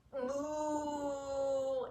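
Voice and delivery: long mooing sound; cow moo. Shown as a demonstration. Showing kids a cow's moo. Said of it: "let's moo like a cow".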